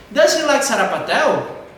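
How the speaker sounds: "Does he like sarapatel" high, loud and slow, tune up at the end; rising question intonation. Shown as a demonstration. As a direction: lift "sarapatel" as a yes-no question. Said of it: "Entonação pra cima no final". ("Does he like sarapatel" com entonação pra cima no final)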